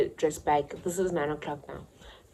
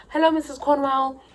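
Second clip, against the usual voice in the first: higher, put-on polite voice; sweet innocent greeting; 10 semitones higher, 5 dB louder than usual. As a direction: sound like a good girl at the gate. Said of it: "Let's just say her name's that".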